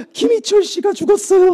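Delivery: high, loud imitated crying; mock wailing. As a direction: cry the name out in hammed-up grief. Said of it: "하면서 막 우는 거예요".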